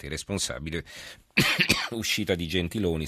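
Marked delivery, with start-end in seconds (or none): 1.3-1.9 sudden harsh cough; a hacking cough that breaks the sentence; chesty cough. Real, not acted. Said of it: "mi continuo a scusare per la tosse".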